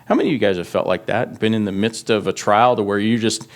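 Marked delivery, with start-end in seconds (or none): none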